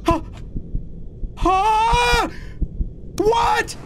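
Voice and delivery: high, alarmed cries; high-pitched panicked wail. Real, not acted. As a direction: blurt it high in bewildered panic. Panics as game scares him.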